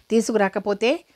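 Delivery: usual speaking voice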